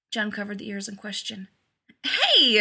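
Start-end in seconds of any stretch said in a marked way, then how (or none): 2.0-2.6 loud, high yelp of protest; sharp indignant outburst. A narrator's reading. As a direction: object loudly, offended. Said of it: "he protested".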